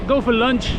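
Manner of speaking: normal talking voice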